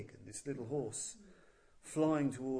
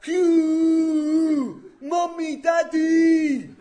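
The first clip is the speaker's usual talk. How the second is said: much higher, much louder howl for Mommy and Daddy; anguished cry for help; 14 semitones higher, 19 dB louder, much slower than usual. Acted. Rudra in agony cries out for his parents, shrieking. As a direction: scream it out in pain like a helpless child. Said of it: "And he called out".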